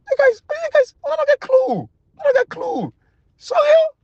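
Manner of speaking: very high, squeaky put-on voice; squeaky mocking voice. Pundit mocking Sierra Leoneans, in a high squeaky voice. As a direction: squeak it like a cartoon mocking the crowd.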